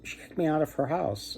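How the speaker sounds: put-on young man lightly sobbing to the condor about his wife; mock crying. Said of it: "and he's crying".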